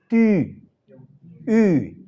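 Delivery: ti as tü, then a lone ü; upsilon's own sound. Shown as a demonstration. Teacher shows upsilon outside a diphthong, isolated ü sound. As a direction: round the lips on ti and u for ü, drawn out. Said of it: "tiene su pronunciación propia".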